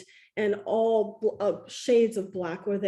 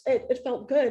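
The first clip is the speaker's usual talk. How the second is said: ordinary talk, nothing marked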